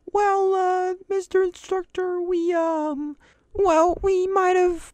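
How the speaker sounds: high, squeaky sheepish voice; sheepish mumble. Retold or imitated. Students confessing to the instructor, high sheepish squeak. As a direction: hedge like a guilty kid, high-pitched. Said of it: "all sheepish and were like".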